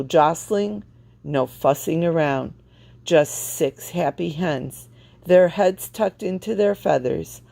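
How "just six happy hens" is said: usual speaking voice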